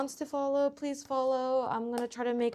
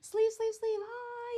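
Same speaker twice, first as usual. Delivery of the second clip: cutesy high voice; 13 semitones higher than usual. Real, not acted. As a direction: coo at them in a high voice. Greeting someone off camera, cutesy.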